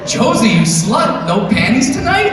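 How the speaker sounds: high, loud, slow catty hollering; tipsy girls teasing at the bar; loud mock party-girl shrieks; rowdy drunk women. Imagined women shouting in trough.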